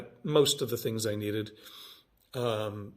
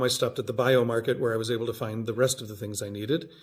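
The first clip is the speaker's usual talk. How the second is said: ordinary talk, nothing marked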